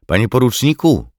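high, loud call to get his attention; urgent call. A narrator's reading. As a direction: call out to your officer, pitched high. Navigator calls out to his officer. (naglące, głośne i wysokie wołanie)

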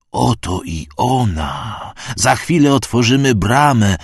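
a burnt priest proclaiming the opening gate; priest repeating, slow then rising. A narrator's reading. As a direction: announce the gate like a zealot. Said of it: "powtórzył wypalony".

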